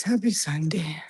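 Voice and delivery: breathy whispered greeting; whispering. Real, not acted. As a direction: whisper it back to the chat. Repeating the chat greeting.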